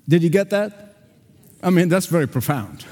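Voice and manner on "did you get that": normal talking voice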